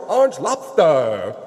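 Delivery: ordinary delivery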